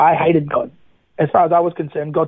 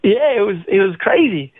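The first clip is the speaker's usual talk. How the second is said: usual speaking voice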